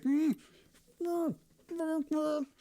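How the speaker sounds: high muffled pleading through a gag; gagged mm. Acted. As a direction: hum desperately with a bound mouth. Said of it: "whose mouth is bound, so she can't speak".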